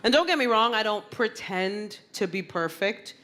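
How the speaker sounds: ordinary talk, nothing marked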